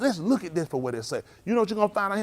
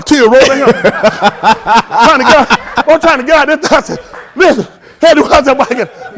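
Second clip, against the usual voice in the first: loud laughter through the words; can barely talk for laughing; laughing hard; 6 semitones higher, 14 dB louder, slower than usual. Real, not acted. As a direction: laugh out the story. Laughing while retelling escape.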